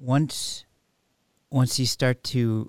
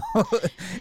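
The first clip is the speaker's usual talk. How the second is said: light laugh; 12 semitones higher than usual. Real, not acted. Laughs at own point.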